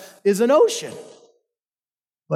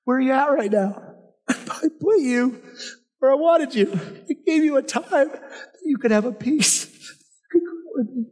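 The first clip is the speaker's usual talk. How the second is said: higher, voice catching with real emotion; tender, choked-up speaking for God; emotional voice of God; 7 semitones higher than usual. Real, not acted. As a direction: let the feeling crack through as you voice God's words. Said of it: "Sorry, I get emotional".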